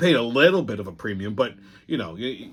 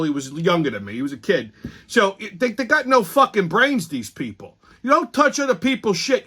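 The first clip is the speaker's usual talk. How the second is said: normal talking voice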